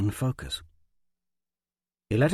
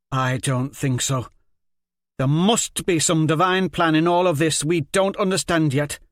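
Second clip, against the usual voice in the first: loud earnest argument; 6 semitones higher, 6 dB louder than usual. Acted. Argues divine plan.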